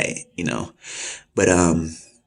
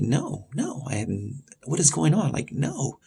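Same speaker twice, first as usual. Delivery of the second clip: retold protest, high and soft; acted-out disbelieving denial; bewildered denial; 8 semitones higher, 3 dB quieter, slower than usual. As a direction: protest in a higher, quieter, baffled voice. Acting out his baffled denial of charge.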